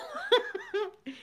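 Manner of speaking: sudden very high real laugh. Real, not acted. Laughing at her own cool-gang joke. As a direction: burst into a high giggle.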